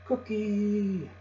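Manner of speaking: very high parrot squawk; piercing, drawn-out bird cry; parrot scream. A narrator's reading. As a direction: screech it like a parrot, last vowel held. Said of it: "it screamed".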